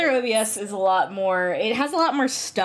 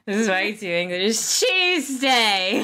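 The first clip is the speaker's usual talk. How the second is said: exaggerated British accent, stretching TUESDAY out; hammed-up English; 3 semitones higher, 3 dB louder than usual. Mocking own too-English Edgeworth voice, hammed-up British. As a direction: ham up the English, Austin Powers style. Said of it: "like, an Austin Powers kind of voice".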